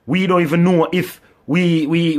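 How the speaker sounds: usual speaking voice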